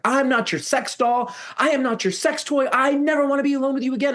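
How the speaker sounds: very high, strained re-enacted protest; retold scream. Teller wants the neighbors to hear, retold screaming. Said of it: "I started screaming at the top of my lungs".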